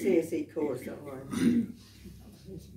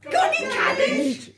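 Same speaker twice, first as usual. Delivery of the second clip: much higher squeaky rabbit asking; cheeky rabbit voice; 7 dB louder than usual. Acted. Rabbit begging the butcher for cabbage, high cheeky squeak. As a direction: pipe it up high like a cheeky bunny. Rabbit's chorus line again.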